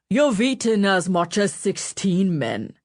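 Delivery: put-on slow, dark complaint in a northern accent. A narrator's reading. Butler Berwick scolds Mancini, northern accent. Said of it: "he complained darkly in his northern accent".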